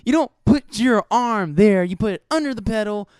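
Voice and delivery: put-on girl scolding. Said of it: "she's like".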